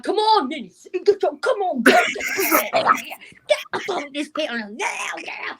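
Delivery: high-pitched, squealing call to come up; excited mock pleading; urging her on. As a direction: holler a friend over, hammed up. Retells calling friend onto panel.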